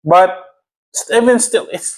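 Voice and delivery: worked-up, high-pitched exasperation; really fed up and worked up; heated. Real, not acted. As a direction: argue it heatedly, voice riding high. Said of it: "I'm so heated".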